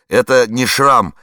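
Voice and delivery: loud, slow protest; exclaiming. A narrator's reading. Warden denying the scar to Lida, loud exclamation. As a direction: blurt it out in denial. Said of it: "воскликнул Алиханов".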